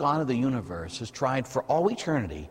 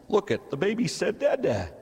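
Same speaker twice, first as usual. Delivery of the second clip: lightly voiced proud dad, pitched higher; a dad's delighted brag, gently played; proud new father; 8 semitones higher than usual. A father hearing the baby's first word, a hint of proud delight. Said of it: "If you're a man, it's".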